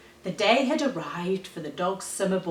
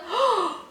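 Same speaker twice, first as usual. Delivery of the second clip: sudden shocked intake of breath; sharp gasp; 13 semitones higher than usual. A narrator's reading. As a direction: gasp as if seeing a disaster. Disaster in the story.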